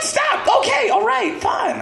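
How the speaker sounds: high, loud, flustered mother's voice; a high, mock-annoyed mom; exasperated mom. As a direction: brush off the kid, high and fed up.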